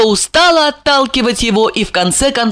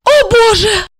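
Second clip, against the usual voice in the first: high-pitched gasp of horror; horrified; 4 dB louder than usual. Acted. Wife recoils from husband's confession, horrified. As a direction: exclaim in shrinking horror. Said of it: "отшатнулась Ольга".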